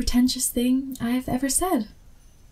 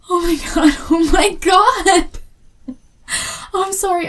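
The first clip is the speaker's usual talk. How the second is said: high, loud starstruck squeal, laughing through it; excited shout; 9 semitones higher, 8 dB louder than usual. Acted. Fan meeting her favourite author, excited high shout. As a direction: shriek with delight at the big reveal. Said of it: "I'm sorry I'm being loud".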